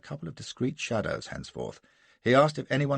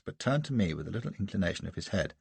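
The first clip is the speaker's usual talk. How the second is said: ordinary delivery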